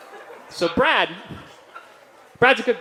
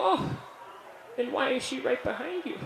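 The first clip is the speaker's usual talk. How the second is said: put-on spooky old man; 8 semitones higher, 8 dB quieter than usual. Spooky reply to the nurse.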